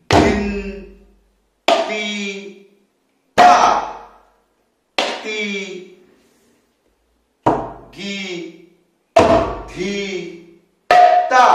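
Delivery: each drum syllable called out alone with long gaps; syllables one by one. Shown as a demonstration. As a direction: count them out slowly like a teacher. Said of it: "একটি একটি অক্ষর ভালো করে সরলভাবে বাজিয়ে দেখাচ্ছি". (একটি একটি অক্ষর আলাদা করে ধীরে বলা)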